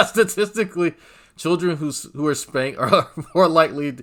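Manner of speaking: high, loud, through laughter; laughing while talking. Real, not acted. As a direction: giggle through the words.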